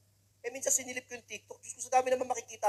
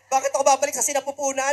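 indignant retort; 4 semitones higher, 11 dB louder than usual. Acted. Son retorts to mother.

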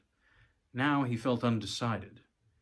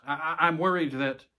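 halting, slightly raised and louder; tightening in pain; 5 semitones higher, 5 dB louder than usual. A narrator's reading. Poll worker warns voter as pain hits, tightening voice. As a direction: stammer as pain takes hold. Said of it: "voice tightened into a hiss of pain".

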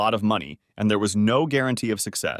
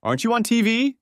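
much higher, excited waitress voice; blurted out; 12 semitones higher, 3 dB louder than usual. A narrator's reading. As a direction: blurt it out, starstruck. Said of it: "she finally blurted out".

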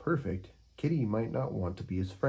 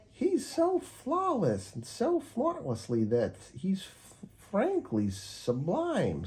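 high, slow swooning parakeet; a bird melting over perfection, stumbling; high, slow parakeet, fumbling a word; 12 semitones higher, slower than usual. Acted. Parakeet character voice.

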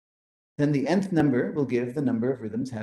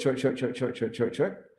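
each short clipped quickly in a row; quick short beats. Shown as a demonstration. Teacher counting out quick short beats. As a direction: tap out quick short syllables. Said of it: "a long syllable takes exactly twice as long to say".

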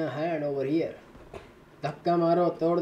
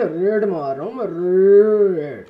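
mock battle cry; 5 semitones higher, 12 dB louder than usual. Gamer hypes himself during raid.

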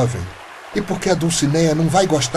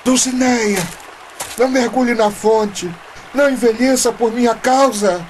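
calling out; 7 semitones higher than usual. Acted. Jeremias calls to Dulcineia at fountain.

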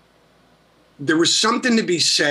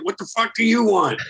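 hostile retort; 6 semitones higher than usual. Retold or imitated. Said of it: "I'm like".

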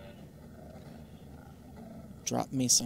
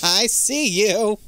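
slow, high, loud spooky tease; creepy taunt; 8 semitones higher, 9 dB louder, much slower than usual. Pretend. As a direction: taunt like a ghost stalking. Spooky taunt in horror game.

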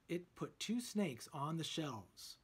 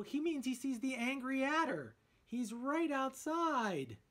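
a higher, louder lightly voiced jittery Shaggy; 9 semitones higher, 4 dB louder than usual. A narrator's reading. Shaggy warns the gang, lightly voiced jittery Shaggy. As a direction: blurt it out, nervous.

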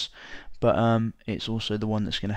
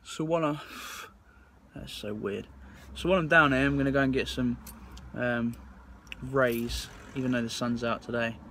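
numb lip; 5 dB quieter than usual. Real, not acted. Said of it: "I feel like I can't talk to you guys".

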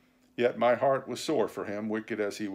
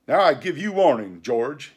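loud, threatening pirate captain; cried warning; 3 semitones higher, 8 dB louder than usual. A narrator's reading. Silver springing up to warn George, loud and threatening. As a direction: warn him off forcefully. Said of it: "he cried".

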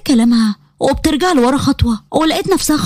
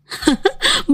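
giggle; 10 semitones higher, 4 dB quieter than usual. Acted. Friend giggles.

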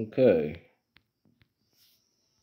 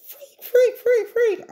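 frantic high-pitched freak out; shrill panic yelping; panicked high yelps; slower than usual. Real, not acted. Panicked in-game scream.

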